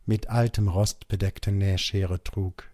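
usual speaking voice

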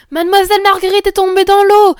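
loud, high cry of alarm; alarmed villagers' yell; women crying out. A narrator's reading. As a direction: shout the bad news so everyone hears. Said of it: "criaient les bonnes femmes".

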